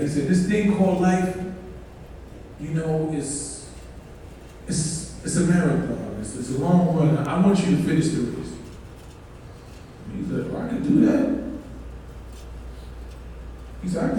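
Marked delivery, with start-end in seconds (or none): 0.3-8.5 lightly voiced father, low and soft; quiet, lower fatherly advice. Father tells son about life, gently advising. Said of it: "his father told him". 10.5-11.4 lightly imitated son, higher and softer. Son answers father's challenge, lightly confident.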